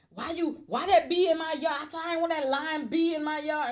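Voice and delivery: high, loud, furious mom yelling; mock the mother's shouting tirade; imitating angry mom. Retelling how her mother shouted about the neighbour, angry and high.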